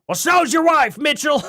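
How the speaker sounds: high, loud mock holler. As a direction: shout it in mock outrage, copying the clip. Re-enacts the clip's yelled punchline.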